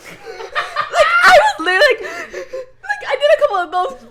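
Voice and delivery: high, loud laughter breaking through the words; laughing hard. Real, not acted. Telling friend about crying in class, laughing hard. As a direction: laugh until it squeaks. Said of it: "no, I was like".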